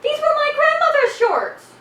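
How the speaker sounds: high-pitched affronted outburst; indignant protest. Acted. Roommate defending grandmother's shorts, indignant. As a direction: protest as if deeply insulted.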